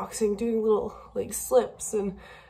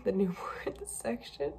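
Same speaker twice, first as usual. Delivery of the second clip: choked-up laugh; 9 dB quieter than usual. Real, not acted. Tearing up over baby clothes.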